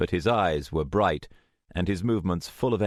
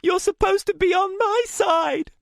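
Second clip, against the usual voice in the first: desperate high-pitched screaming plea; loud, very high panicked outburst; voice rising, breaking; 6 dB louder than usual. A narrator's reading. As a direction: plead at the top of your voice. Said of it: "Rachel's voice rose, broke".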